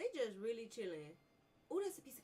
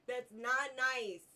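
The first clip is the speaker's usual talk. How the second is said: slow, louder self-scolding; mock scolding; 4 semitones higher, 9 dB louder, much slower than usual. Real, not acted. Gamer mock-scolds himself for laughing. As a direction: scold yourself in mock seriousness. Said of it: "I should not laugh".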